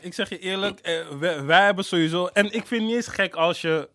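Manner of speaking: ordinary delivery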